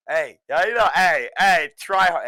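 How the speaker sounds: ordinary talk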